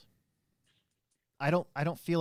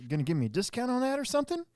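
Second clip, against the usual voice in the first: much higher and fast, mock whine; cheeky customer; 12 semitones higher, 3 dB louder, faster than usual. Customer asking manager for a discount, high quick mock whine. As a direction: imitate the customer fishing for a discount. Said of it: "he's like".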